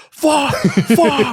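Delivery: high, loud comic scream; hammed-up frustrated yell; venting outburst. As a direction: let it rip behind a closed door. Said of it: "closing the door, and just being like".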